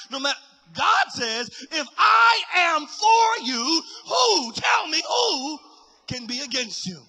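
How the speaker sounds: loud, high, driving sermon delivery; preaching at full force. Real, not acted. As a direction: build through insistent repetition to a shouted climax.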